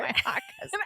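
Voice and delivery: very high, softened giggly squeal; laughing echo. Real, not acted. Listener echoing the bride's words, squealing with laughter. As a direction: repeat it through high laughter. Listener echoes the quote, amused.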